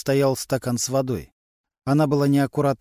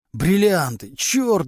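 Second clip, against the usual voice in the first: a loud, higher howl of despair; howling outburst; 4 semitones higher, 4 dB louder, much slower than usual. A narrator's reading. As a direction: burst out wailing at the word. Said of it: "взвыл Глущенко". (отчаянный громкий вой)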